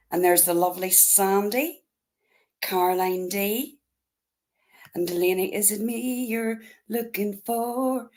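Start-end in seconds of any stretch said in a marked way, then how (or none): none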